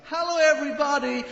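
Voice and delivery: high, showy emcee greeting; mock worship leader. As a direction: ham up a preened worship leader on stage. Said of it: "Worship leader".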